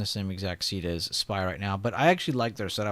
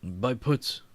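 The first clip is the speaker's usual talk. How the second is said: caveman voice; 2 semitones higher than usual. Pretend. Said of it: "sound like a caveman".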